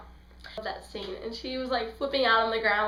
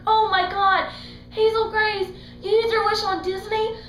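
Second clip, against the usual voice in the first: higher, louder mock outrage; exasperated Augustus; 10 semitones higher, 6 dB louder than usual. Augustus scolding Hazel over her wish, mock outraged. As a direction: raise it into disbelief.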